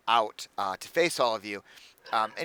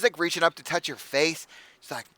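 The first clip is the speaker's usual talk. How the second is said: ordinary delivery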